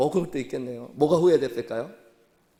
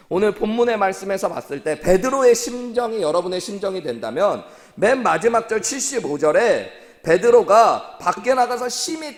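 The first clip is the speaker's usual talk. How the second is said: higher, louder pleading sermon voice; impassioned preaching; 7 semitones higher, 8 dB louder than usual. Acted. Pastor urging the congregation to feel Peter's grief, impassioned and loud.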